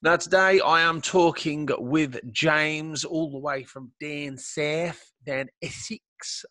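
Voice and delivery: slow, badly faked Essex accent; terrible Essex accent. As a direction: do a drawn-out, terrible Essex impression. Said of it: "terrible accent of mine. Uh, impression".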